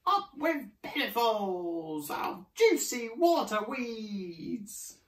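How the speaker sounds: high, slow duckbill chant; drawn-out hooted cheer; hooting. A narrator's reading. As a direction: hoot it high and let it fall away. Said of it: "And they hooted".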